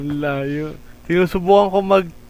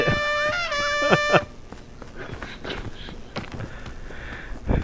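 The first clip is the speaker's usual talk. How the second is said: high giggle; 9 dB quieter than usual. Real, not acted. Friend struggles climbing.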